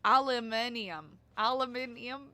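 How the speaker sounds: "aluminium" as al-uh-MIN-ee-um; British ALUMINIUM. Shown as a demonstration. Streamer imitating how the British say it, al-uh-MIN-ee-um. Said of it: "They say it like it's".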